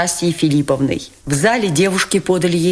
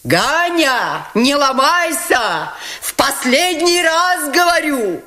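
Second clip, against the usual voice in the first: roaring; 9 semitones higher, slower than usual. A narrator's reading. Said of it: "заревел Фердыщенко".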